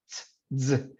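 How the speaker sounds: "Ts" and "z" released from tongue against the teeth; isolated dental affricates "Ts" and "z"; affricate demo. Shown as a demonstration. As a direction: sound out "Ts, z" in isolation, closure then friction. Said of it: "cierre con la lengua contra los dientes".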